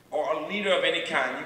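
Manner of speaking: ordinary talk, nothing marked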